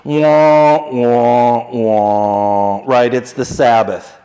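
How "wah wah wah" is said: descending mock wah-wah; wah-wah sound effect, falling; sad trombone. Shown as a demonstration. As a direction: sing a falling sad horn. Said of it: "if there were music playing".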